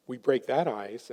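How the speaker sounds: ordinary talk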